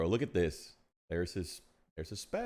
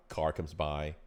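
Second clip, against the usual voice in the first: normal talking voice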